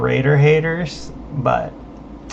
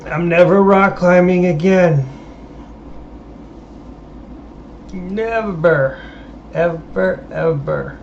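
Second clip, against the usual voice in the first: whiny vow; 4 semitones higher than usual. Pretend. Whining vow after sore muscles.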